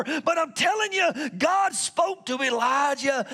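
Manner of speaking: preaching at full force. Acted. Pastor shouting to the congregation about expectations. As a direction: build it up and shout it to the church.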